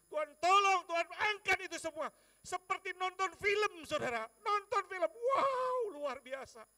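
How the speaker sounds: very loud, high, awestruck shout; impassioned preaching. Real, not acted. Preacher marvelling at God's past help, impassioned shout. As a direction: proclaim it in wonder at full force.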